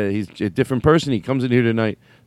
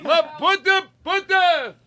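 captain shout; slower than usual. Pretend. Playing ship captain yelling orders.

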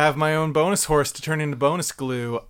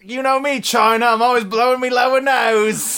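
put-on Cockney accent; 5 semitones higher than usual. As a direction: ham up a London Cockney geezer. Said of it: "Cockney rhyming slang".

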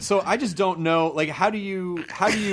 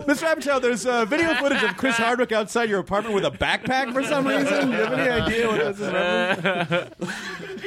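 mock reporter; 7 semitones higher than usual.

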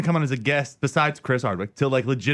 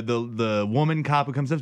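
ordinary talk

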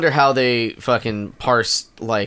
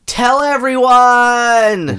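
high, slow and loud; drawn-out exclaim; 11 semitones higher, 6 dB louder, much slower than usual. Real, not acted. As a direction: stretch it out gleefully. Exclaims joyfully.

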